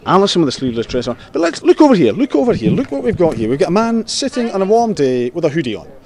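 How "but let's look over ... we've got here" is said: excited calling out. Real, not acted. Host calls attention to hoodie man.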